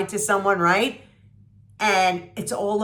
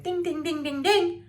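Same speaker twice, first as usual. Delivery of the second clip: bell chime; 9 semitones higher than usual. Retold or imitated. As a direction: chime it like a little doorbell. Mimicking a show's bell chime.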